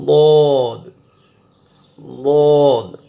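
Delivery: ضاد loud and long, tongue side on molars; heavy daad. Shown as a demonstration. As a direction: say ضاد thick with rounded vowel. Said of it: "موٹا کر کے پڑھنا ہے". (موٹا کر کے ضاد)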